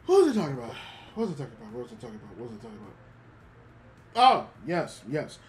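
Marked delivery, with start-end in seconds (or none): 1.2-2.9 soft, fading repeat of the question; trailing off. Real, not acted. Streamer forgetting the topic, fading to quiet. 2.9-4.5 higher and louder burst of the question; frustrated outburst. Real, not acted. Streamer forgetting the topic, bursting out louder.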